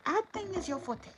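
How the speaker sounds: high, lightly voiced mother; a quick high put-on mother voice; quoting mother. Tanessa quoting her mother to the king: high mock mother voice. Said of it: "My mother always said".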